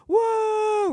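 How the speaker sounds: high, drawn-out mock crowd whoa. Audience reacting to a stage question, mock whoa. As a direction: gasp like an impressed audience. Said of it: "people, you know, go".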